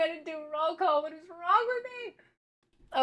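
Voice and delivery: high, flustered self-scolding. Real, not acted. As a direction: panic at the forgotten roll call. Forgot roll call, flustered.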